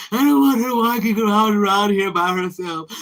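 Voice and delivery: lightly voiced anxious mom; worried mother. Mother retelling her fear for her daughter, mildly worried. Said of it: "I was just like".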